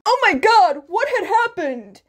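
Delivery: high, loud alarmed outburst; shocked. A narrator's reading. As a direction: gasp it out in sudden shock. Teacher finds injured students.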